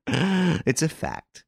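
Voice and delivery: a chuckle before speaking; chuckle, then state it with amusement; laughing. A narrator's reading. Renine mocking his own fears for the captive, chuckling. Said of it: "Renine began to laugh".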